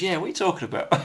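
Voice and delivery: high, puzzled cut-in on the radio; lightly voiced confused dad; retold dad's puzzled question. Telling a friend about dad on the CB, high puzzled question. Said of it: "He goes".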